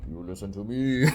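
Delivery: menacing villain. Acted. Count voiced menacing in manga reading.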